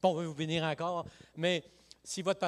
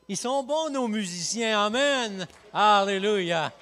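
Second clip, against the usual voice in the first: rising, louder cheer for the musicians; excited praise; 6 semitones higher, 8 dB louder, slower than usual. Real, not acted. Preacher cheering the band before the congregation, excited praise. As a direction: lift the room, praising loudly.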